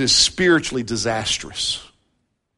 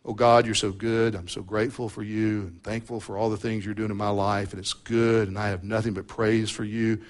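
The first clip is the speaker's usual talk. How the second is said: much softer, lower mock-sweet prayer; fake pious prayer; 8 semitones lower, 11 dB quieter than usual. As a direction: pray as if putting on a show of praise. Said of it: "pretend like I'm not upset with him".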